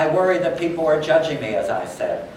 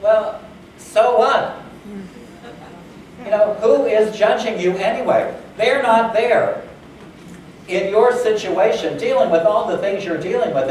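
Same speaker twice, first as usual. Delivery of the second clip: emphatic challenge; 7 semitones higher, 4 dB louder than usual. Retold or imitated. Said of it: "woman, I said".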